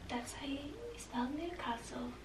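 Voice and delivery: whispered aside. Shown as a demonstration. Spelling out her home town for viewers, whispered. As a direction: breathe it with no voice at all.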